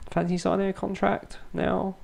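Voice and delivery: high, soft hopeful request, then giving in; mock coaxing. Manager asking a player to sign a contract, mock coaxing. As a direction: coax lightly, then pretend not to mind.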